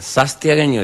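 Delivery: "Sastiagueño" with an S where the N goes; Santiago accent. Retold or imitated. Samaniego names his homeland as "Sastiagueño". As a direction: say "Sastiagueño", swapping the N for a hissed S. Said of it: "le sacaba una N para agregarle una S más".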